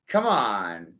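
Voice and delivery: ordinary talk, nothing marked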